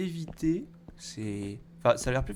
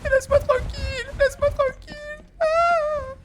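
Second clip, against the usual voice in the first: shrill crying shriek, very high; terrified shrieking; 10 dB louder than usual. Real, not acted. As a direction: beg in real terror, near tears. Monster still chasing him. (cris aigus terrifiés)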